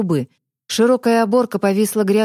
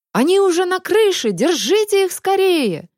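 alarmed shout; 9 semitones higher than usual. Acted. Pursuers spot them on roof.

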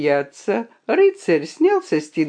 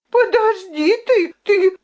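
high, louder threat; threatening; 13 semitones higher, 3 dB louder than usual. A narrator's reading. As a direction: shake a fist with your voice. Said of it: "грозила она гонцу".

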